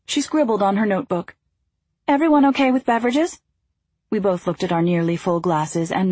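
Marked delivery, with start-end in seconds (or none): 2.1-3.4 bright, quick attendant voice; chirpy service voice, higher and quick; flight attendant voice. A narrator's reading.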